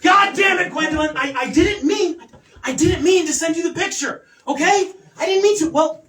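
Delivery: high-pitched, defensive protest; flustered, indignant self-defense; exasperated quarrel. Acted. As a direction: snap back at someone accusing you. Acted quarrel with Gwendolyn.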